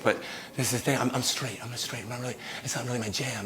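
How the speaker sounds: low, very soft and hesitant; hushed awkward refusal. Acted. Man turns down the president, hushed and awkward. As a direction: let them down gently under your breath.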